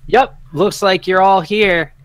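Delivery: slow, high and loud; bluffing stablehand. Acted. Stablehand pretends to read the book to the party, slow and loud. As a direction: pretend to read with false confidence. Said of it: "And he's like".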